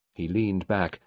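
ordinary delivery

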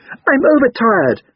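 high, loud, drawn-out whine; wailing overtired child. A narrator's reading. Boy in tears echoing his mother's excuse, wailing. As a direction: wail slowly like a worn-out child.

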